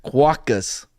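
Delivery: Batman with a New Zealand accent. Shown as a demonstration. Host showing off their best accent, New Zealand Batman. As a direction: squeeze the vowels into a comic New Zealand accent. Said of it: "My New Zealand accent".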